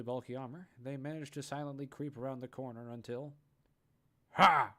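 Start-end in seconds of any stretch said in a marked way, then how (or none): 4.3-4.7 loud flat "Ha!"; monotone blurt. Acted. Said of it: "they blurt out in a monotone voice".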